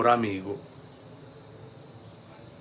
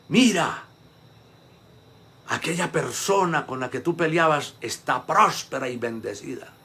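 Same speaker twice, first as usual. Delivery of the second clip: ordinary talk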